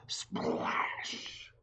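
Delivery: ordinary delivery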